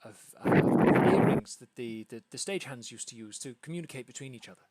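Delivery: fast, excited stammering impresario; lightly voiced flustered theatre manager; excited hostess. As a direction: babble the plan out breathlessly. GM voicing frantic hostess.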